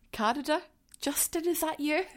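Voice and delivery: high, playful mock-hopeful call; teasing excitement. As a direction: tease him like he might be listening.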